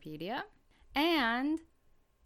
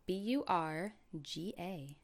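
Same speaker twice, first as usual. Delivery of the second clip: spelling it out; 5 dB quieter than usual. Shown as a demonstration. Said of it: "How do you spell that?".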